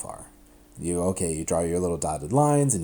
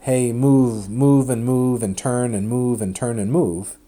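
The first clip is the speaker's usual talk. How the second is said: louder, insistent voice of the arrows, slowing down; the arrows pushing loudly, move and turn; arrows urging on; 3 semitones higher, 6 dB louder, slower than usual. Pretend. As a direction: boss the solutions around as the arrows. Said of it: "lots of arrows here and here saying".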